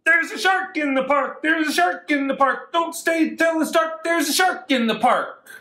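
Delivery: high, fast, urgent warning; excited alarm. Acted. Librarian reading to kids, a high excited alarm. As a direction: call out the shark alarm to the park.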